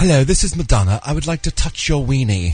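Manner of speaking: put-on British accent, breathy sultry tone; fake British accent. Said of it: "the annoying fake British accent".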